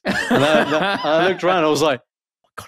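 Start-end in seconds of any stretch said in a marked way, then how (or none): 2.0-2.7 whispered mock disbelief; barely voiced, awestruck whisper; hushed amazement. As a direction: gasp it under your breath. Retelling his hushed amazement on plane.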